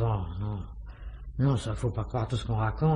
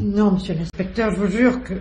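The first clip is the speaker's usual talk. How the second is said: higher and louder, pleading denial; frightened protest; 7 semitones higher, 4 dB louder than usual. Acted. Accused concierge pleading with the inspector, frightened and high. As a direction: beg him to believe you.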